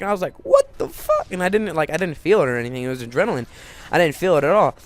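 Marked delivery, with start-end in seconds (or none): 0.4-1.3 very high-pitched startled outburst; retold shock. Kid seeing his injured leg, imitated high shock. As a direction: squeak it out in mock disbelief.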